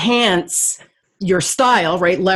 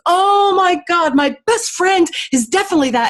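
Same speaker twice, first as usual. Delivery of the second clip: high, amazed retelling of the host; host suddenly getting it, high and amazed; excited host's realization; 7 semitones higher than usual. Retold or imitated. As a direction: gush it like a sudden revelation. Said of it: "she goes".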